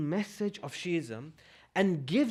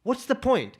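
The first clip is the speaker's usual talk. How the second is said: ordinary delivery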